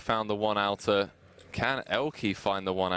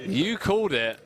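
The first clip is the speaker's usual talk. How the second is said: excited; 10 semitones higher, 4 dB louder than usual. Real, not acted. Commentators celebrate the call.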